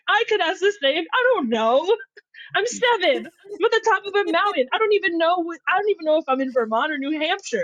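put-on a lost seven-year-old protesting; exasperated kid. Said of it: "And I was like".

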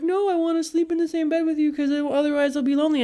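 lightly mock the needy partner's plea; whiny partner voice.

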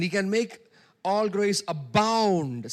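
slow, loud, ringing preacher proclamation; preaching at full force. Acted. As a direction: stretch the verse out loud like a pulpit cry.

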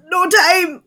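high, loud burst of urgency; Sarsa's urgent cry. Acted. Werewolf rounding the corner, urgent cry. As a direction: cry out in a panicked hurry. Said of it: "and she says".

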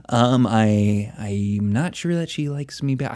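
lightly done radio DJ voice; a hint of a smooth countdown host; Casey Kasem voice. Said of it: "almost like a shitty Casey Kasem".

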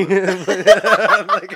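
laughing loudly. Real, not acted. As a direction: burst out laughing. Laughing mid-sentence.

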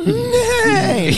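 a shrill laughing whinny; mock horse neigh. Horse joke, neighing reply.

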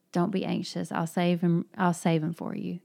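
ordinary talk, nothing marked

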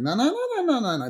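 high swooping singsong nonsense syllables; singsong intonation. Shown as a demonstration. Mother mimicking English speakers' intonation, singsong. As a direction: mimic English speakers' sing-song lilt. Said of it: "it's like they sing a little".

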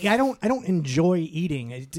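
ordinary talk

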